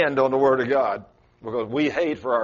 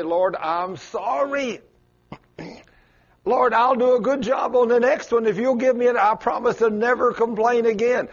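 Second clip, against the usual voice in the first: high, pleading apology to God; humble pleading; 9 semitones higher, 3 dB louder than usual. Acted. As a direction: beg forgiveness meekly. Humbled person begging forgiveness.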